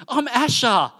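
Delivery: put-on high, slow, chirpy little-girl voice; imitating a bold, friendly small child; little girl Asher. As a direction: bounce up to strangers like a five-year-old. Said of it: "she'll be going".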